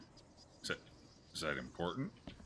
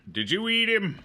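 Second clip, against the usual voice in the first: high, loud incredulous question; startled shout; 13 semitones higher, 8 dB louder than usual. Real, not acted. Player confronting a monster in a game, loud incredulous shout.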